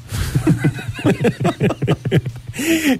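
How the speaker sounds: burst of laughter. Real, not acted. Host cracks up at joke.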